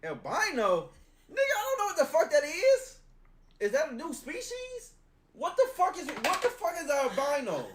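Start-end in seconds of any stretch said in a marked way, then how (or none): 1.3-7.7 incredulous outburst. Real, not acted. Baffled by word albino.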